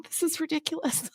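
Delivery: teary and soft; crying, worn out. Real, not acted. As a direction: speak through real tears. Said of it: "why I can't stop crying".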